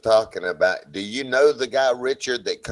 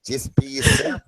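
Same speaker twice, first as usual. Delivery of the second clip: Australian accent; 5 semitones higher than usual. Shown as a demonstration. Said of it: "Do I do pretty good with that?".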